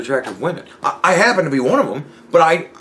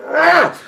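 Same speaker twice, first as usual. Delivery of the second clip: mock shocked yelp; 3 dB louder than usual. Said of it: "And I'd go".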